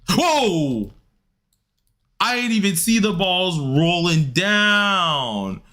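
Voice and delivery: high, drawn-out cry of surprise; startled exclamation. Real, not acted. Player caught out by rolling balls in the game, startled wail. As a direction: wail it out slowly, caught off guard.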